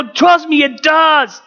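dramatic retort. Retold or imitated. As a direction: yell back in a reenacted marital row. Reenacted shouting match with wife.

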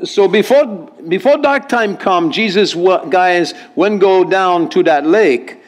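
lilting Hawaiian Pidgin reading. Acted. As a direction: read it out in a Hawaiian Pidgin accent. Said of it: "translation from our English into Hawaiian Pidgin".

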